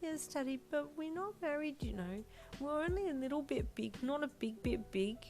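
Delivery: put-on high child voice, correcting him; a girl setting her dad straight; indignant little girl. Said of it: "she said with indignation".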